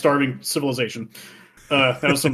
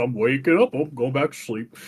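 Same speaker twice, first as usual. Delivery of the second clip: softer, dozing off at the end; drowsy Goron voice; 3 dB quieter than usual. Retold or imitated. Goron nodding off when Link walks by, fading sleepy voice. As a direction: drift back to sleep as you say it. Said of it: "so he's like".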